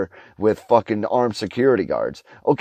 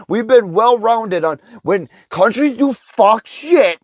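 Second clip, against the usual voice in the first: furious yelling; 11 semitones higher, 5 dB louder than usual. Real, not acted. Ranter shouts at viewers who believe it, high and loud. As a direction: rage at how dumb this is.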